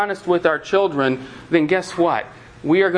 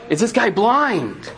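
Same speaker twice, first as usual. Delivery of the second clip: incredulous thought; 5 semitones higher, slower than usual. Retold or imitated. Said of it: "you think to yourself".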